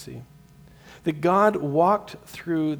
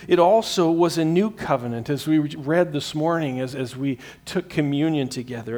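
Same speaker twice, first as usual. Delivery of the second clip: ordinary delivery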